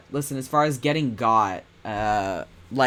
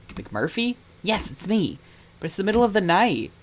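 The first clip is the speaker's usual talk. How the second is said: put-on higher girlish voice, startled then hurried; a young woman woken at night, a bit surprised; Kara's voice; 8 semitones higher than usual. Kara answering McMurphy at night, high and startled.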